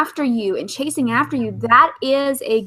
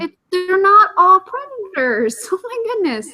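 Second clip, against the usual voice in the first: put-on girly doll voice; 8 semitones higher than usual. Slips into girly voice again.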